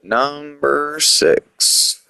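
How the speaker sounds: drawn-out announcer call, high pitch; countdown announcer. Acted. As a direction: stretch it out like a countdown host. Announcing ranking number.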